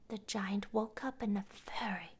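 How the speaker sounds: ordinary delivery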